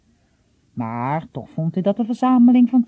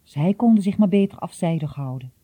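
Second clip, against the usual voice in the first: plain reading voice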